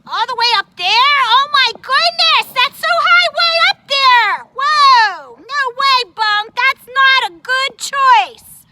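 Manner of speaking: loud, high gasping alarm; alarmed exclamation. Acted. Puppet hearing Bunk climbs high, alarmed shout. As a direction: cry out in panic at the danger.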